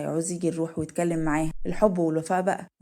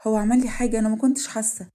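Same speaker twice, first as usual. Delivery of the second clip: higher, louder startled questioning; shocked; 4 semitones higher, 6 dB louder than usual. Acted. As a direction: react with alarm at the news. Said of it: "وهي تتصدم". (مصدومة ومش مصدقة)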